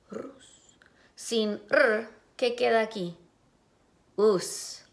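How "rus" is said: whispered repeat. Shown as a demonstration. Maestra repite la sílaba susurrando.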